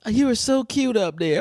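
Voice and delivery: lightly voiced gushing fan; mock patronizing. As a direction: coo at someone condescendingly.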